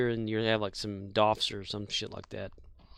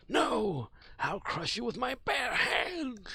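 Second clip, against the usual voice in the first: angry shout; 11 semitones higher than usual. Pretend. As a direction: roar it up high in defiance. Acting Megatron's defiant roar.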